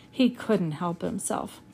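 plain reading voice

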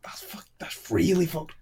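shocked whisper. Real, not acted. Shocked at fish and chips claim.

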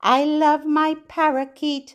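bright sing-song rhyme for babies; nursery rhyme reading. Acted. Reading a rhyme to babies, sing-song. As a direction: read it lilting, to a little one.

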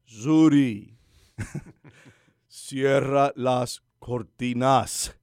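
very slow, drawn-out command to a smart speaker. Shown as a demonstration. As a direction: stretch every syllable of "cierra las cortinas" out slowly. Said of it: "Déjame decirlo más despacio". (orden a la computadora dicha muy despacio)

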